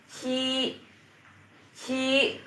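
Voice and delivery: ci held high and level. Shown as a demonstration. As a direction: keep ci high and flat, first tone. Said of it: "Ci nadanya nada satu ya".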